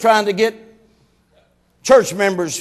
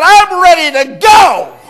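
fed-up wife; 6 semitones higher, 7 dB louder, slower than usual. Retold or imitated. Wife complaining, wants to leave.

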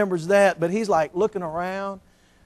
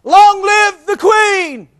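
high, slow, loud British cry of Long live the Queen; hammed-up English patriot leaping out; Englishman's cry; 12 semitones higher, 8 dB louder, much slower than usual. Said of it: "the Englishman stepped into the door".

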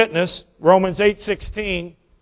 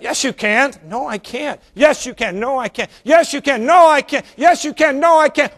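escalating argument; 7 semitones higher than usual. Retold or imitated. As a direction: build the squabble line by line. Escalating back-and-forth argument.

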